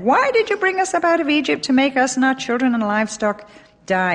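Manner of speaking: high-pitched protest read aloud; grumbling complaint. A narrator's reading. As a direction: wail it mildly as an aggrieved crowd's grumble. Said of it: "they grumbled against Moses and said".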